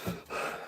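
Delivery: re-enacted breathless gasps; short, voiceless huffs of breath; out-of-breath panting. Runner showing a friend how winded they were, panting. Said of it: "how out of breath I am".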